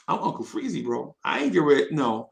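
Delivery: ordinary talk, nothing marked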